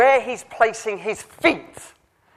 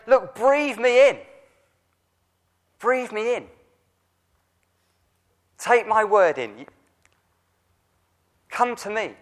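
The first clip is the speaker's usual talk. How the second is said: tender divine invitation; 3 semitones higher than usual. Acted. God's tender call to Elijah.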